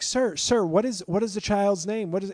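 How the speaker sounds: urgent nurse. Retold or imitated. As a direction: keep asking him, urgently. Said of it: "the nurse is like".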